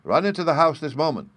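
loud, high-pitched command; vexed father's order. A narrator's reading. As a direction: order the children in sharply. Vexed father orders children indoors.

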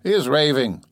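mildly tipsy, high-pitched exclamation; tipsy cry. A narrator's reading. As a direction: cry out as if a little drunk. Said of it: "Razumihin cried tipsily".

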